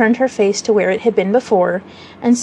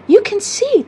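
much higher, sarcastic jab; mock him with biting sarcasm, pitched up high; sarcastic exclamation; 11 semitones higher, slower than usual. A narrator's reading. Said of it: "she exclaimed with frightful sarcasm".

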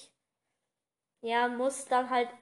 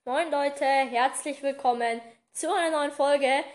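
energetic, loud podcast greeting; loud intro; 6 dB louder than usual. Real, not acted. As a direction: open the show full volume. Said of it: "wenn ich halt neue Moin Leute sage".

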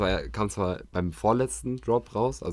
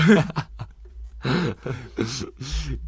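long laugh; 3 semitones higher than usual. Real, not acted.

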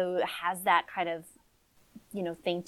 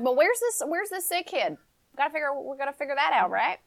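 higher, breezy casual chatter; chatty young woman; 7 semitones higher, faster than usual. Acted. Amber eyeing the family's house, higher chatty voice. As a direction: rattle on like a curious, offhand visitor.

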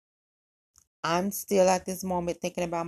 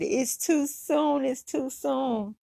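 slow and pitched up, teasing; amused protest; 7 semitones higher, much slower than usual. Retold or imitated. She answers his "I miss you" text, slow, high and teasing. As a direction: drag it out high, laughing him off.

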